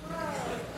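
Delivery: soft imitated gasp; sharp hushed intake of breath. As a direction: gasp like stunned onlookers. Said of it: "third were like".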